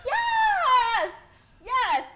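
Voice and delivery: high, loud thrilled yell; excited yelling. Retold or imitated. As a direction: cheer like a delighted fan. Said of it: "I was like".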